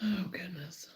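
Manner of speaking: breathy whispered aside; whispering. Real, not acted. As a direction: whisper it to yourself. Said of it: "unvoiced whisper".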